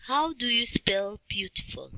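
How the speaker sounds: whispered question to the class; hushed teacher prompt. Shown as a demonstration. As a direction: breathe the question without voice. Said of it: "repeated question, whispered".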